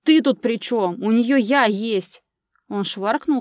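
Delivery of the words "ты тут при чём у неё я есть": loud, possessive outburst, slowing down; roaring. A narrator's reading. Ivan claiming Angela against his rival, furious roar. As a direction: shout it, staking your claim. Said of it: "взревел Иван".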